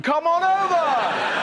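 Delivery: high, slow, drawn-out holler; showy call-out. Acted. Harry inviting viewers to his show, showy high call-out. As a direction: stretch it into a big mock invitation.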